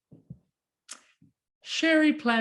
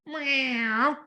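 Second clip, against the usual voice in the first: higher mewing; cat replies to dog; cat meow; 7 semitones higher than usual. Acted. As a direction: mew like a cat. Cat answers.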